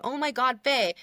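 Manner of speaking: mock screaming fan, slightly toned down; excited exclamation of recognition; imitated fan scream. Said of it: "like, screaming".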